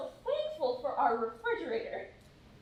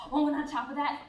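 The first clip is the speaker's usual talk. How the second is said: ordinary talk, nothing marked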